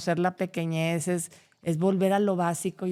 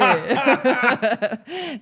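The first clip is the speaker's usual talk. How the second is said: loud, high giggling; real laughter; 8 semitones higher, 7 dB louder than usual. Real, not acted. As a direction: laugh again before going on. Still laughing.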